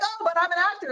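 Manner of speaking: high-pitched, hammed-up self-defence; mock protest. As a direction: plead your case in a playful high voice. Retelling own protest to daughter.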